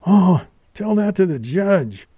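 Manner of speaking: high-pitched scornful retort; wounded woman's high outburst; indignant cry. A narrator's reading. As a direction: cry it back at him in outrage. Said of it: "cried Geraldine".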